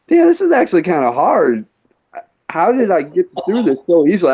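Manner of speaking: puzzled at his own younger skill; baffled complaint. Retold or imitated. Acting out his reaction to old games.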